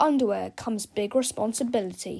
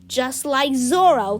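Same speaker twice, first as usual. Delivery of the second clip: grand boast; 3 semitones higher, 7 dB louder, slower than usual. Acted. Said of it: "said Melvin".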